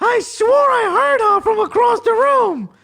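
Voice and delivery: drawn-out high mock shout; imitating Justin. Friend insisting he recognised her, high excited yell. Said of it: "And he's like".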